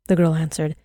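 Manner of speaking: usual speaking voice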